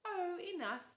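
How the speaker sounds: put-on high, sing-song old auntie; sweet old lady. A narrator's reading. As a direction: chirp it like a doting aunt. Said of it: "Auntie Em said".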